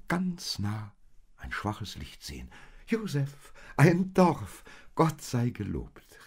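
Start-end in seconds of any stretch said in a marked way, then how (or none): 2.9-6.2 high, loud happy exclamation; joyful cry. Acted. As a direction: cry out in relief at the village. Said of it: "rief Maria froh".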